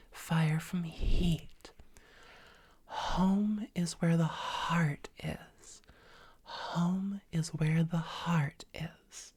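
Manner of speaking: slow breathy whispered phrases, long open H; extended breathy H. Shown as a demonstration. Coach demonstrating slow breathy H phrases to students. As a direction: let the breathy H spread over each phrase, slow. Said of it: "It's gonna sound like this".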